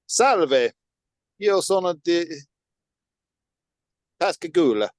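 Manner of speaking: punchy mock-Italian; loud opening, Italian lilt; Italian accent. Shown as a demonstration. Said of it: "say it with an Italian accent".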